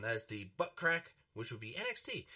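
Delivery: usual speaking voice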